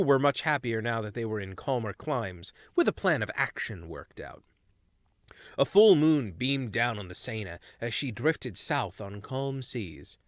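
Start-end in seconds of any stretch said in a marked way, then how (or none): none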